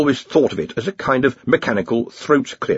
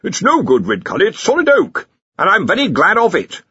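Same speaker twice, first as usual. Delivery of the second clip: higher-pitched, bickering retort; arguing wizard; 10 semitones higher, 4 dB louder than usual. A narrator's reading. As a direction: argue back at a fellow wizard. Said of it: "largely by arguing with each other".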